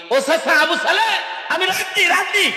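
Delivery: high, loud acted cry of agreement; shouted consent. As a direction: call out agreement at the top of the voice. Hero shouts his consent. (চিৎকার করে সম্মতি)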